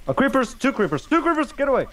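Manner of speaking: high, alarmed panic; panicked. Real, not acted. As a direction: flee the creepers in alarm. Fleeing creepers in game.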